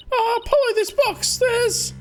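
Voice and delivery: put-on squeaky high-pitched girl voice; high character voice. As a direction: pitch it way up, panicky.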